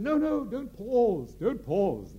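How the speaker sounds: high, slow, loud theatrical protest; a grand director cutting an actor off; Gielgud directing. Retold or imitated. Sir John stopping the actor mid-speech, high and loud. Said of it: "Sir John said".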